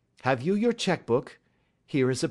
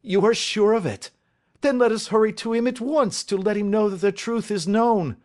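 high, raised, urgent voice; urgent excitement; 8 semitones higher, 5 dB louder than usual. Acted. Father learning his son is innocent, urgently excited. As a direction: press to set off at once.

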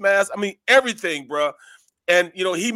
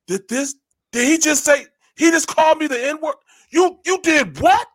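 retold shocked outrage; 8 semitones higher, 4 dB louder than usual. Teammate retells guard's shocked outrage.

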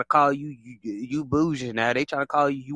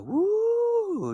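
mock cheer. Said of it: "they be like".

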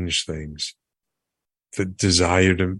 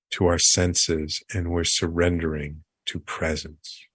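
guided meditation. Acted. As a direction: guide them calmly into the moment.

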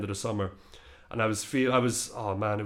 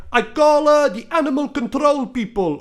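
high, loud impression of an old Dutch man; hammed-up Dutchman shouting from a balcony; 12 semitones higher, 6 dB louder than usual. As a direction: call down as a Dutch neighbour. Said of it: "somebody shouted from".

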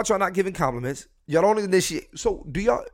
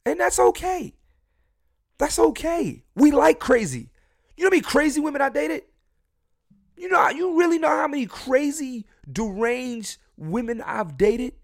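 high, loud, bursting with glee; almost shouting his delight, pitched way up; excited; 10 semitones higher, 4 dB louder than usual. Real, not acted. To the podcast audience about dating crazy women, excited high shout.

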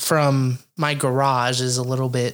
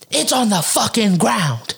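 raised-pitch mock ranting; irate neighbor lady; 5 semitones higher than usual. Lady ranting about the dirty alley, mock exasperated. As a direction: mimic a fed-up complaint, leaning on the opening words. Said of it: "She goes".

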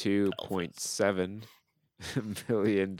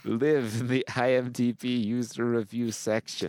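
hammed-up accent, drawing the words out; Tom Hanks movie accent; 6 semitones higher than usual. Impressionist speaking for real reviewers, exaggerated put-on accent. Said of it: "Tom Hanks doesn't know what that accent is".

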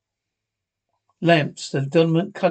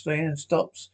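plain reading voice

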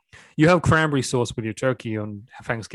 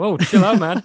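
mock yell; 6 semitones higher, 6 dB louder than usual. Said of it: "You know".

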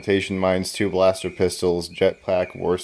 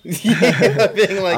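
giggling through the words; laughing; 9 semitones higher, 6 dB louder, much slower than usual. Real, not acted. Player laughing at a teammate's joke, laughing hard. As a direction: laugh it out, amused at the ditching joke.

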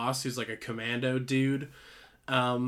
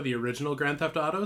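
usual speaking voice

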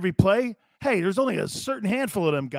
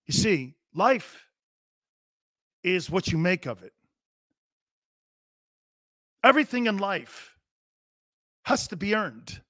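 mock soliloquy. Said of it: "maybe a soliloquy".

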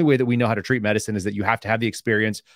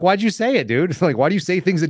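higher, louder incredulous jab; exasperated jab; 8 semitones higher, 4 dB louder than usual. Retold or imitated. Ex-agent to rookie on his empty jargon, incredulous and higher. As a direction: needle him for saying nonsense.

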